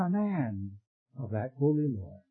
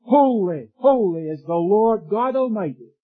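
louder, lifted "Holy, holy, holy"; ringing proclamation; 4 semitones higher, 7 dB louder than usual. Acted. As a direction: proclaim it like a heavenly choir. Quoting the living creatures' praise.

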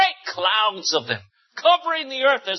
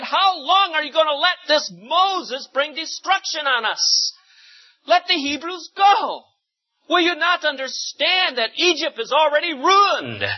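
urgent pleading; 8 semitones higher than usual. A narrator's reading. Egyptians pleading with Pharaoh.

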